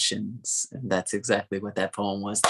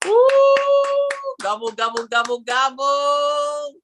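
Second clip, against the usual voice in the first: much higher, slow gobbling; high turkey gobble; 5 dB louder, slower than usual. Retold or imitated. Host imitates the turkey gobble.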